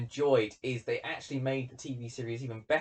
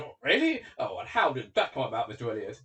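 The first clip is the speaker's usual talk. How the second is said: even more shocked; 4 dB louder than usual. Acted. Colonel questioning Elliot, even more shocked. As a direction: play a taken-aback interviewer, voice jumping up at first. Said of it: "looks even more shocked and says".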